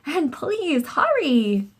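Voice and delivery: high, slow pleading call; boy begging the firemen to hurry; urgent plea. A narrator's reading. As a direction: beg them to hurry. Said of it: "I yelled".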